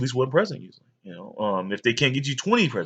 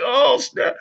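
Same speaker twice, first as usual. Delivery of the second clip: put-on higher, louder busted kid; a kid caught red-handed, pitched up; mock oh snap; 13 semitones higher, 4 dB louder than usual. Boy caught by his mom, higher oh snap. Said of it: "I'm just like".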